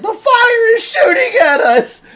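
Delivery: high, loud, laughing terrified yell; hammed-up fear through laughter; panicked shout. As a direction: scream it slowly like fireworks are hitting you. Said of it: "he's like".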